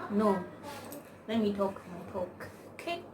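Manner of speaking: ordinary talk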